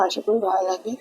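normal talking voice

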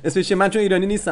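ordinary talk, nothing marked

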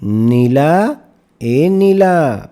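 drawn-out, rising call across the yard; mother calling her daughter outside. Acted. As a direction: call the older girl from afar. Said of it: "तिने ताईला हाक मारली".

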